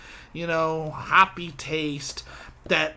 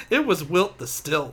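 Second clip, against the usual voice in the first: ordinary talk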